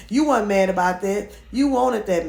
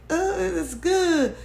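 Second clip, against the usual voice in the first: slow, higher, half-hearted mock approval; fake approval; 8 semitones higher, much slower than usual. Vlogger mimicking her forced approval, slow and half-hearted. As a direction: drag it out like forced politeness. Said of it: "But it was a fake".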